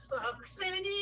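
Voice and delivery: high, loud sudden shout; sudden outburst. Retold or imitated. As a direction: blurt it out, yelling. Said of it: "doing that thing where she".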